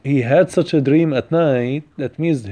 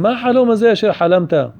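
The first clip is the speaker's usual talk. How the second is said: mild mock yell, pitched up; retold scolding; 6 semitones higher, 3 dB louder, much faster than usual. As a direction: imitate a father's rebuke, higher voice. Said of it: "he screamed at him".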